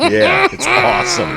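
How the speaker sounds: high slow laughing yell; laughing shout. Real, not acted.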